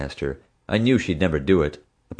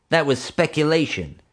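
huffy; 7 semitones higher than usual. A narrator's reading. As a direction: brush her off with cold certainty. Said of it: "Marge huffed".

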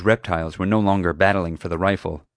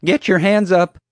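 high, loud guard's order; high-pitched, urgent command; nervous shouted command; 12 semitones higher, 7 dB louder than usual. A narrator's reading. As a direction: shout it like an unsure young guard. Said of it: "He looked very nervous".

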